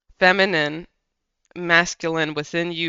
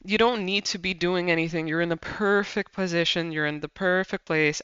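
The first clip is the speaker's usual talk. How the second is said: ordinary delivery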